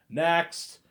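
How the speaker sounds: high, loud clerk's call. Retold or imitated. Adoption clerk to the waiting line, loudly calling out. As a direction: call the queue forward.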